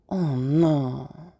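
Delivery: "Oh no" drawn out smoothly with no punch; not underlined. Shown as a demonstration. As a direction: let "Oh no" glide by, barely reacting. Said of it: "we're not underlining that at all".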